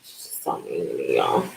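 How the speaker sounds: whispered aside. Real, not acted. Telling viewers her mother is mean, whisper. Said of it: "aside to viewers".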